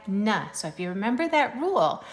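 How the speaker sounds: normal talking voice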